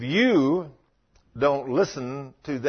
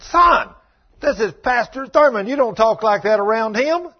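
loud, high scolding of his son; stern father scolding; 7 semitones higher, 8 dB louder than usual. Retold or imitated. Father loudly scolds son in front of pastor. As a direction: bark the rebuke at the boy.

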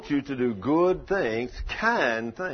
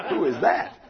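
acted-out startled question, higher and louder; 6 semitones higher, 6 dB louder than usual. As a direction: ask it as the startled witness, half laughing. Startled man hears corpse singing.

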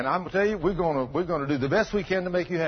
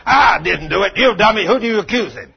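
loud, higher, scornful snap; mock angry retort; 5 semitones higher, 10 dB louder than usual. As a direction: bark it back as the man expected.

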